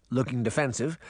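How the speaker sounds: plain reading voice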